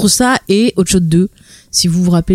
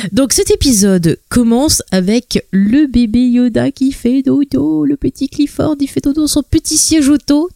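put-on cutesy baby talk; 6 semitones higher, slower than usual. Cutesy narration of sleeping baby.

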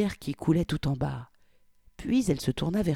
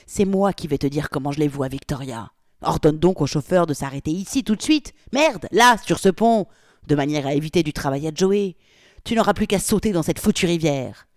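furious threat; 7 dB louder than usual. Acted. Polly furiously threatening Victoria.

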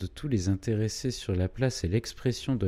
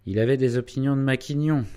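loud, quick rebuke; stern; 5 semitones higher, 6 dB louder, faster than usual. A narrator's reading. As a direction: reprove sharply, voice raised. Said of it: "dit sévèrement le docteur".

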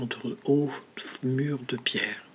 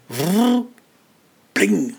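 mechanical whirr; 7 semitones higher, 8 dB louder than usual. Acted. Imitated spring mechanism noise.

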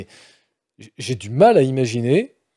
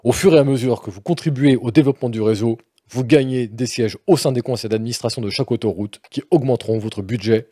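sérieux et rigueur; 4 semitones lower than usual. Pretend. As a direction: lecture austère, voix plus basse. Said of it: "tout le sérieux et la rigueur que m'inspire ce jeu". (lecture d'un sérieux austère)

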